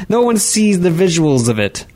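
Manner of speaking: high-pitched, exasperated retort; annoyed anger. Real, not acted. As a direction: drive the point home, annoyed with the co-host. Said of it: "hear the anger in your voice".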